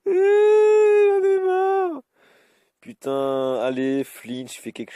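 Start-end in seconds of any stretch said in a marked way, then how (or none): 0.0-2.0 very high, loud, very slow fake crying wail; mock wailing. Frustrated gamer wailing at his losing fight, hammed-up cry. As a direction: howl it out like a tantrum.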